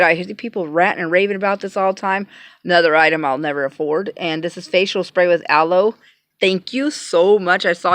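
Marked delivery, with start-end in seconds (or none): none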